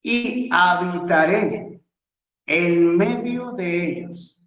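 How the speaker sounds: measured teacher's read-aloud, words drawn out; slow, emphatic reading. Real, not acted. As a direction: read the verse out slowly, stretching each phrase. Reading a key verse slowly for students.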